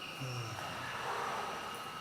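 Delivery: soft unvoiced breath out; quiet sigh out; long exhale. Shown as a demonstration. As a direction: release the air slowly. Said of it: "One more time".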